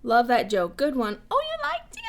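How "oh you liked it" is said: very high little mock whine; high playful squeal. Answering a viewer who loved the joke, high squeal.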